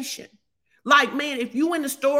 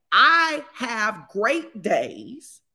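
drawn out slowly, each word weighed; very slow declaration; much slower than usual. Acted. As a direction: stretch out every word like a vow. Preacher declares.

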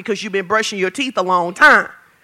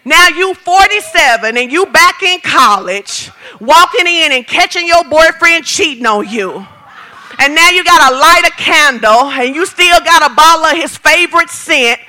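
ordinary talk, nothing marked

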